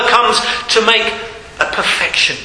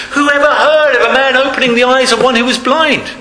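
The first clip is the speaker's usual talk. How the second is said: indignant retort; 7 semitones higher than usual. Retold or imitated. Healed man retorts to accusers.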